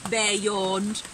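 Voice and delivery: put-on bright, animated read-aloud for kids; lively storybook reading. A narrator's reading. Teacher reading Robin's winter story aloud, animated and bright. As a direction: act the story out with energy.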